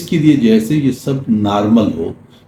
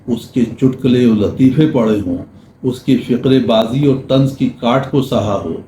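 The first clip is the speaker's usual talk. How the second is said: normal talking voice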